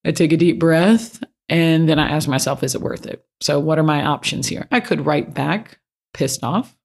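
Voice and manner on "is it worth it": soft whisper, asking herself. Retold or imitated. Pausing over an annoying email, a whispered self-check. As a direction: breathe the question under your breath.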